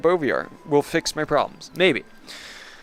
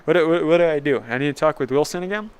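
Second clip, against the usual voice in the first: ordinary delivery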